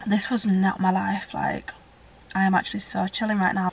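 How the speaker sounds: breathy aside whisper. Real, not acted. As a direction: whisper it to yourself. Aside about her new routine.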